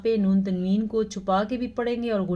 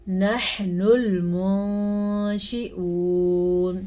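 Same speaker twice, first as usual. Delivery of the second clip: slow melodic tajweed chant; measured Quranic recitation; much slower than usual. Shown as a demonstration. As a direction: recite slowly, drawing out each vowel. Recitation demo.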